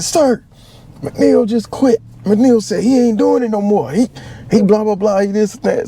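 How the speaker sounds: a whiny drill instructor snitching; high mock tattletale. Drill instructor reporting McNeil to his senior, high mocking tattle.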